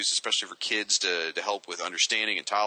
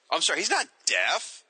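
sudden correction; 5 semitones higher than usual. Real, not acted. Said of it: "I've been saying it wrong".

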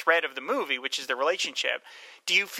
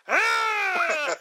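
high loud mock scream with a laugh; imitated scream; 5 dB louder than usual. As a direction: scream it like a cheap sound effect. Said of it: "of course it's".

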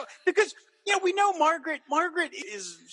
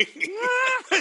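much higher, sharp exasperated cry; mad outburst. Retold or imitated. Margaret hearing Frank's will, a high exasperated cry. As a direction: let out a flash of outrage. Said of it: "she's so mad".

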